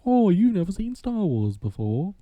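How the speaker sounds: slow, high British voice imitation; mock a British reviewer, drawn out and high; high, slow put-on British accent. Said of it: "the British guy".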